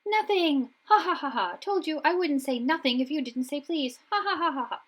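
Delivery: high loud mocking cackle; high gleeful poltergeist cackling at Filch. A narrator's reading. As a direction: gloat like a prankster. Peeves mocks Filch and cackles.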